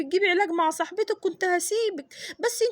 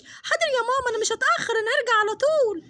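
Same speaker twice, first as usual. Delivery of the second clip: put-on higher child promising; little girl; 5 semitones higher than usual. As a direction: obedient daughter's promise. Daughter promises to return. (طفلة بتوعد مامتها)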